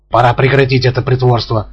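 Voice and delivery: plain reading voice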